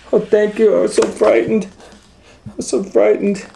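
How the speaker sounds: put-on very high, loud little girl voice; relieved little girl crying out. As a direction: gush with relief.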